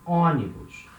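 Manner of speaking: ônibus with a nasal first O. Shown as a demonstration. Teacher to class, modelling ônibus with nasal O. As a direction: start ônibus with O through the nose. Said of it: "it has a nasal sound".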